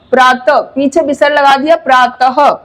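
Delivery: प्रातः ending with a breathy हा after प्रात; visarga demo. Shown as a demonstration. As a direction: add a short हा to प्रातः. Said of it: "उच्चारण होता है वो हा होता है".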